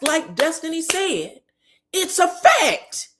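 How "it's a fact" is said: FACT drawn out high and loud; emphatic declaration. Real, not acted. Recapper confirming Destiny's diagnosis, slow high emphasis on "fact". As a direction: stretch "fact" out, pitched up and louder. Emphatic punchline about friendship Tourette's.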